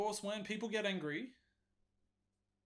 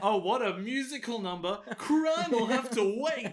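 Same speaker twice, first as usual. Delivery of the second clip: hammed-up theatrical airhead; 4 semitones higher, 5 dB louder than usual. Mocking the girl who ignores a stabbing, swooning and theatrical. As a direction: sing it out like a dreamy musical star. Mocking a character's musical response.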